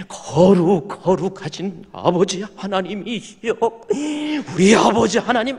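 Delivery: a mock elder leading public prayer; pious prayer voice. Elder leading church prayer, hammed-up pious tone. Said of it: "대표 기도할 때".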